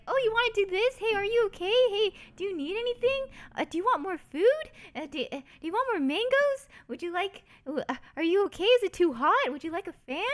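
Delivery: high, fussing, eager-to-please voice; doting family members. Retold or imitated. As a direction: pitch it up and fuss over the guest like a caring auntie. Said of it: "her family was just like".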